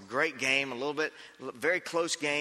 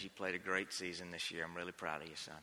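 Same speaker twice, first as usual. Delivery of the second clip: tender consoling coach; 10 semitones lower, 12 dB quieter than usual. Retold or imitated.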